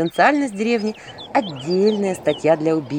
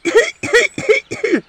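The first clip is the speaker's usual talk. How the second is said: put-on old man's wheezy cough; creaky old cough; hoarse cough; 5 dB louder than usual. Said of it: "сипловато покашливая".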